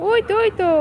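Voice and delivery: high excited pointing out; thrilled look-there call. Real, not acted.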